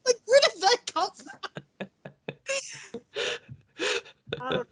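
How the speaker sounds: high, laughing squeak; cracking up while asking what; giggly disbelief. Real, not acted. Laughing at the funny line.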